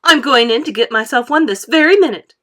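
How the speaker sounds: loud bratty declaration; defiant. A narrator's reading. Veruca defies Wonka, loud and bratty. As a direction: announce it defiantly.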